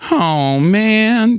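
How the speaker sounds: high whiny complaint; mock whining, like a sulky kid. Shown as a demonstration. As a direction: whine like a kid who wants to stay. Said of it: "try that with me".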